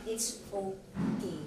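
soft, low and very slow; gentle reassurance. Real, not acted. Telling listeners crying is allowed, gently reassuring. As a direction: draw it out softly to soothe. Reassures those who need to cry.

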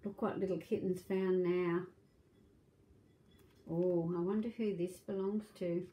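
ordinary delivery

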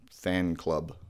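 ordinary talk

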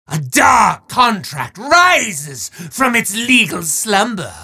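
hammy dark-lord decree rising to a yell; evil wizard proclamation. Acted. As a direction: relish every sinister word like a horror host.

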